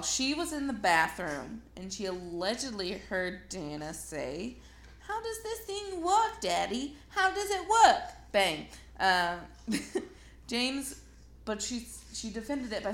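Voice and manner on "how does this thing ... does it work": put-on toddler voice. Toddler quoted before shooting.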